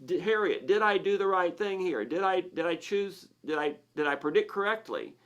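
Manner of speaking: a worried little voice, lightly hammed up; neurotic insecure lizard brain. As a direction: fret like a nervous creature begging for reassurance. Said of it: "the lizard brain is neurotic and insecure".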